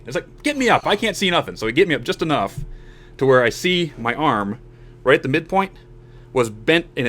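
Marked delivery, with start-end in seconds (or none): 0.4-1.6 high-pitched mock insistence; retold insistent demand. Injured player demands coaches lift him, retold insistence. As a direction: act out demanding a hand up.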